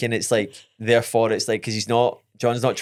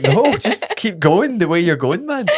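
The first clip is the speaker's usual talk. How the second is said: high, slow plea through laughter; mock exasperated reassurance; 8 semitones higher, 4 dB louder, slower than usual. Passenger begs driver to carry on, mock exasperated. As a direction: plead comically with the driver.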